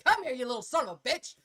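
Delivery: loud, fast, snapping yell at a son; fast, loud mock-furious call; exasperated yell. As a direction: blow up like a mom pushed too far. Mom losing patience with son.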